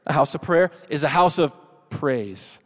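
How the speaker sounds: loud congregational call; call and response. Shown as a demonstration. Preacher leading the crowd in a loud call. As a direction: lead the room in a loud call-out. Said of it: "would you say this with me?".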